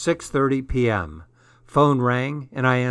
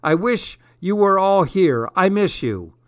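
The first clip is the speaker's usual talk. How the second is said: dad's odd, higher-pitched voice; weirder voice; 6 semitones higher, 4 dB louder than usual. A narrator's reading. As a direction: say it strangely, pitched up and louder at first. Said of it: "His voice sounded even weirder".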